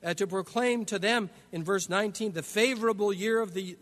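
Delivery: ordinary talk, nothing marked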